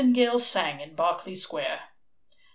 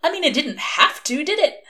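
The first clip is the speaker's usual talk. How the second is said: manic; 3 semitones higher, 8 dB louder than usual. A narrator's reading. Crowley presses his manic point.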